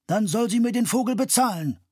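loud, high insistent demand. A narrator's reading. The bird seller demands payment, insistent. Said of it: "verlangte der Mann".

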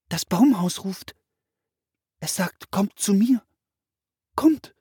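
put-on high girlish voice, rising to the last word; calling, beckoning. A narrator's reading. Girl tells brother the tree house is calling, high beckoning voice.

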